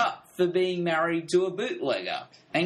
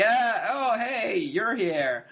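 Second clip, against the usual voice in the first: higher, slow, fumbling mock surprise; caught-out Nucky; 7 semitones higher, much slower than usual. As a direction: stall like a man caught out. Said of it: "He's like".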